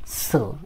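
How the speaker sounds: "su" said low and soft; low, quiet demonstration of "su"; model "su" pronunciation. Shown as a demonstration. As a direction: pronounce "su" softly for learners to copy. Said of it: "এটার মতো উচ্চারণ করবেন".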